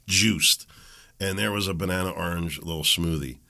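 ordinary talk

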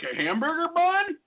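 high incredulous question; mock disbelief. Pretend clerk hears the odd bun request, incredulous. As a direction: act baffled by the order. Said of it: "They'll be like".

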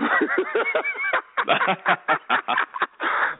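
breathless squeal of laughter; high wheezing laugh. Real, not acted. Recalling convincing his mother, wheezes with laughter. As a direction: let out a long high wheeze.

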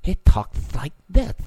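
slow, lisping "this" with the s through the teeth; mock lisping impersonation; lisp demo. Shown as a demonstration. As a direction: show the lisp slowly on "this". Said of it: "He talks with a lisp".

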